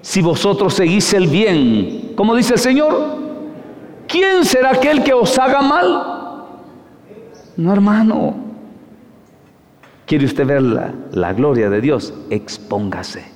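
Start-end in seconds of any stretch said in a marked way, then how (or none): 0.0-8.3 much higher, fervent rebuke; preaching at full force. Real, not acted. Preacher rebukes a weak congregation in a fervent high cry. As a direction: call the flock out with fire.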